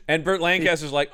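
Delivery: ordinary talk, nothing marked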